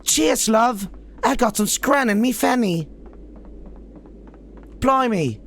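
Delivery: high-pitched, over-the-top British squeal; squeaky parody of a British girl; mock British Tracer. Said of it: "when you're playing as Tracer, it's".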